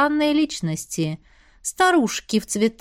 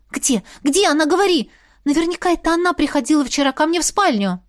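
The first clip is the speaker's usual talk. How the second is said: higher, louder eager demand; excited demand; 6 semitones higher, 3 dB louder than usual. Acted. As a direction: grab him and demand answers. Лара трясёт Вита за плечи. (взволнованное требование)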